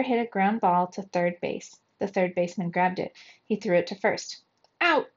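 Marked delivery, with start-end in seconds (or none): 4.8-5.1 short umpire's call; umpire's shout. A narrator's reading. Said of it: "the umpire shouted".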